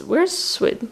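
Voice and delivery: usual speaking voice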